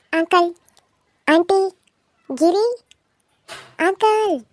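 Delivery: calling out. Acted. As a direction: shout for the elders to come. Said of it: "ಯಾಕ್ ಹಂಗ್ ಕಿರ್ಚಾಕತ್ತಿ".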